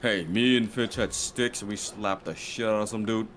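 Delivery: ordinary talk, nothing marked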